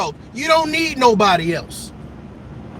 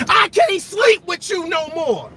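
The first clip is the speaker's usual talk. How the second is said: mock declaration; 4 semitones higher than usual. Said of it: "tell yourself".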